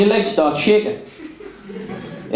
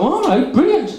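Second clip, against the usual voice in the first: mock delight; 8 semitones higher than usual. Enjoying it, mock approval.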